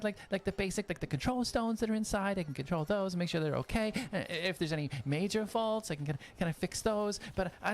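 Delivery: high-pitched apprentice, fussing over a sick automaton; Jonas, caring and eager. Acted. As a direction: offer help, nervous but kind. Jonas talks gently to Atlas.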